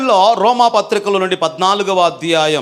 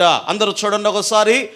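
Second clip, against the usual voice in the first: ordinary talk, nothing marked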